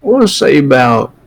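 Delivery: put-on slow, frail old woman haggling; slow old lady. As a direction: savour the price like an old seller.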